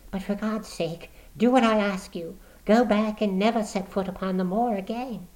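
low eager plea. Acted. Woman secretly warning Watson, low and eager. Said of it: "She spoke in a low, eager voice".

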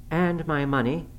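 high, defiant add-on; angry defiance. A narrator's reading. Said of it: "returning his angry glare".

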